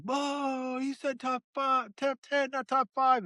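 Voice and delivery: high, excited overlapping chatter; hammed-up high voice of arguing fast food workers; mock arguing coworkers. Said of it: "they're all arguing back and forth".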